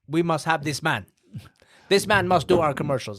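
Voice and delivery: high, put-on voice of a foreign client; hammed-up imitation of an eager client; Bahamas client. Imagined Bahamians demanding this man, put-on client voice. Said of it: "He doesn't talk like that".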